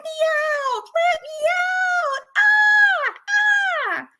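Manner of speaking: very high, loud shrieking cry; squawking parrot shriek; imitated parrot scream. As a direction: screech it like a parrot copying a trapped woman. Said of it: "a woman screaming in the house going".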